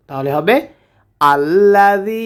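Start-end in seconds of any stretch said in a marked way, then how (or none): 1.2-2.3 আল্লাযী opening on a clear আ; alif with fatha. Shown as a demonstration. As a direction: begin আল্লাযী with an open a sound. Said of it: "আলিফের ওপর একটা জবর ধরে নেব". (আল্লাযী শুরুতে আলিফে জবর ধরে)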